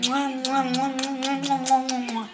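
soft repeated kiss sounds; blown kisses. Real, not acted. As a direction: blow kisses softly. Blowing kisses to viewers.

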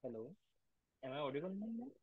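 very faint, far-off voice; weak and distant, mic too low; barely audible. Real, not acted. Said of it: "Your voice is very low".

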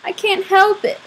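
high-pitched, mildly tearful protest. A narrator's reading. Boy crying in front of his friend, tearful. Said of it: "And that made me cry".

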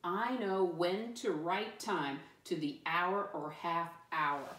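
measured choral-reading pace; every word given room, deliberately; slow read-along. Shown as a demonstration. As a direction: read slowly so a class can join in. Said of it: "Read it with me this time".

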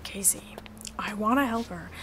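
hushed whisper in a huddle; whispered aside. Acted. Frankie whispering to Casey in a huddle. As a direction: whisper so Susie can't hear.

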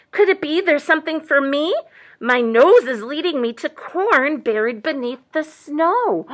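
lightly voiced, hopeful buck; a hint of rising wonder; curious buck. A narrator's reading. Buck smells corn under snow, lightly voiced curiosity. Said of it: "said the buck".

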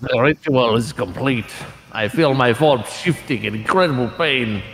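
dramatic voice acting. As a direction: intone it like a solemn game monologue. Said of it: "You ready for more voice acting?".

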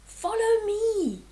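high, urgent storybook yell; panicked dad's narrated yell; yelled alarm. A narrator's reading. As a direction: call out high to your family to flee. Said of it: "Dad yelled".

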